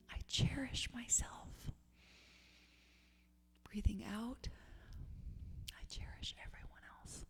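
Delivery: barely audible whisper; hushed, whispered meditation close; whispered mantra. Real, not acted. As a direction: let the final affirmation dissolve into breath. Last round of meditation chant.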